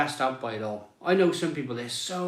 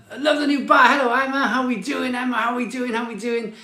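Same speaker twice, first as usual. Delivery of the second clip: much higher, bright hello to a chat viewer; excited greeting; 8 semitones higher than usual. Real, not acted. Host greeting Emma in the live chat, excited. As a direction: sing out the hello like spotting a friend.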